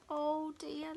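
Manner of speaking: plain reading voice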